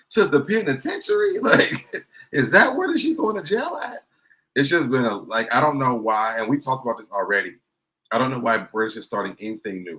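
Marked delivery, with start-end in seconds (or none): 0.1-4.1 much higher, louder squeal of disbelief; mock incredulous host. Host asking where she's headed, high mock disbelief. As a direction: ham up the host's shocked question. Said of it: "And Carlos is like".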